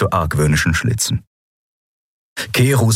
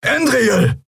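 called out. Acted. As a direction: shout her name to warn her. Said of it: "rief Keru". (laut rufend)

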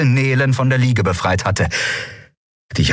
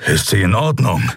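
grumbled. Acted. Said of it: "brummte er".

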